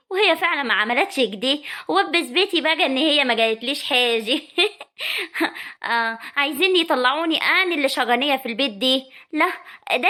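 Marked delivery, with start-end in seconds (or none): 4.5-5.8 sly laugh. Acted. Sara alone, scheming.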